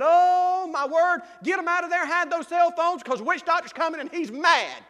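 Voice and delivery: loud, high frantic alarm; quoting Ailton's frantic warning; panicked warning. Retold or imitated. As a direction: sound the alarm, urgent and high.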